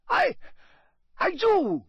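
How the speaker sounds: high, halting, anxious stammer; breathless. A narrator's reading. Karkaroff assuring Crouch he has names, breathless. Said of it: "said Karkaroff breathlessly".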